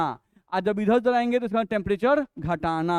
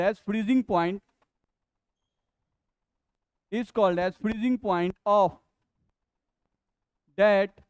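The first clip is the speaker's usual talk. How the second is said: very slow, word-by-word reading; slow dictation; much slower than usual. Acted. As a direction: dictate the definition with long pauses between phrases. Dictates a written definition slowly.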